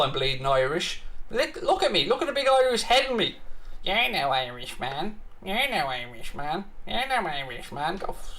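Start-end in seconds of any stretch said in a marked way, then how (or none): 3.8-8.3 mocking voice. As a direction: parrot the insult back in a dopey mock voice. Mocking a chat commenter's insult.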